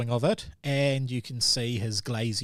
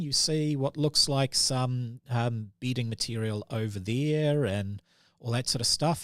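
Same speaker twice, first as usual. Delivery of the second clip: ordinary talk, nothing marked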